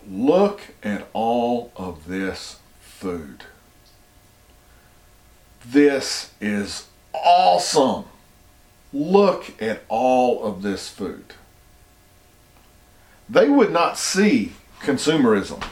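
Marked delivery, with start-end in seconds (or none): 0.1-11.3 awestruck. Retold or imitated. Tribe marvels at Walmart food.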